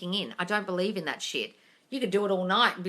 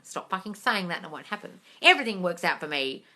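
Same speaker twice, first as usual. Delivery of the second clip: ordinary talk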